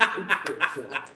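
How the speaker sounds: bright laugh at his own punchline; a burst of real laughter. Real, not acted. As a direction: laughs at the joke.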